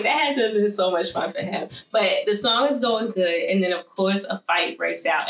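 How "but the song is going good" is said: normal talking voice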